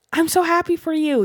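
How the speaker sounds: high, thrilled congratulations; excited friend. Retold or imitated. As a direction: squeal it out like an overjoyed friend. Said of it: "he came over like".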